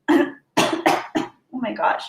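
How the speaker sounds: croaked out after a coughing fit; choking on peanuts. Real, not acted. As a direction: barely get it out between coughs. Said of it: "peanuts in my throat".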